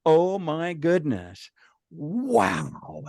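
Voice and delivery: slow, hushed wonder; awed spelunker. Retold or imitated. Caver finding an amazing cave formation, slow imitated awe. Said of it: "they'd see this and go".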